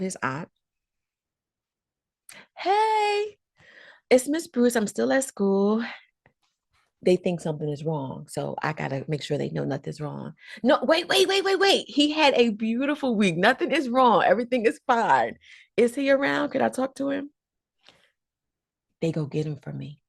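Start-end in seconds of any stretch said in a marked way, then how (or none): 2.6-5.8 high, polite phone voice; friendly phone greeting. Retold or imitated. Teacher calling aunt on phone. 10.6-17.3 high, quick reassuring rush; hurried reassurance. Retold or imitated. Reassuring the worried aunt.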